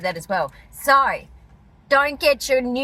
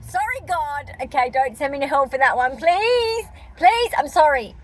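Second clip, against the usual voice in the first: mock pleading; 3 semitones higher than usual. Begging God's pardon for a joke, mock pleading. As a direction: beg forgiveness in mock panic.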